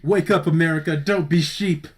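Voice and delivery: loud, high conspiracy preacher; paranoid late night rant. Pretend. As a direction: shout like a paranoid pundit. Joins the mock conspiracy rant.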